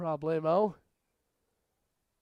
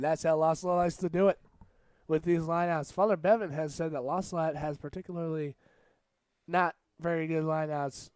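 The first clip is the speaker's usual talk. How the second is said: usual speaking voice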